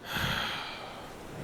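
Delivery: soft, breath-only sigh of surrender; yielding sigh. Acted. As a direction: sigh softly as you give in. Dragon gives in to companion.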